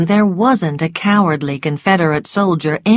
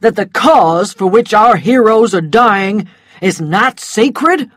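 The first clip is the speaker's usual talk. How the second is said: louder, indignant challenge; angry gentleman demanding an answer; an offended man confronting Rhett; 3 semitones higher, 6 dB louder than usual. A narrator's reading. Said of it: "an angry voice arguing with him".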